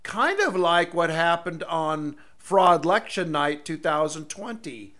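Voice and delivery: normal talking voice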